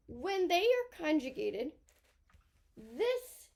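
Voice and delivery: ordinary talk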